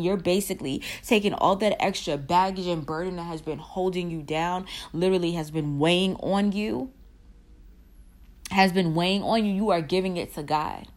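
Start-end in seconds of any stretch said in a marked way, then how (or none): none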